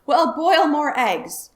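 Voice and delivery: loud, slow, high bellow; roaring. Acted. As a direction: roar the order at her. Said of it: "roared Mister Wagon Wheel".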